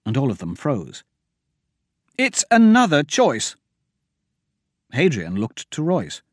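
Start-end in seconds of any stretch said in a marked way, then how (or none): 2.2-3.5 high, urgent warning; pitched-up alarm. A narrator's reading. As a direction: make them see the danger. Myra warning of a trap.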